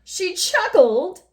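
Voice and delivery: high, loud, drawn-out mock wail. A narrator's reading. King appalled at Miss Sunshine's chuckle, theatrical wail. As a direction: wail it slowly in disbelief. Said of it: "wailed the king".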